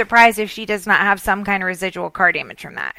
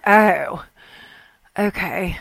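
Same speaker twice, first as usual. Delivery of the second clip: low, deflated imitation, dropping softer; disheartened; 4 semitones lower, 4 dB quieter, much slower than usual. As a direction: give up quietly, as if let down. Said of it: "hangs up the phone completely disheartened".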